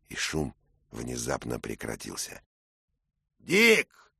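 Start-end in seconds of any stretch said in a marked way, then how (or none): 3.4-4.1 much higher, loud bellowed name; knight's shout. Acted. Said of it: "кричал рыцарь".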